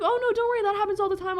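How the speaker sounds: high, friendly cashier voice. Retold or imitated. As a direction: reassure brightly like a cashier. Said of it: "He's like".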